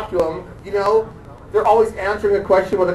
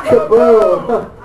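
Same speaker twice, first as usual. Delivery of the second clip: louder mock explosion with a laugh; kaboom sound effect; 7 dB louder than usual. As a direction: toss off the blast sound. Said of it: "I just do the kaboom".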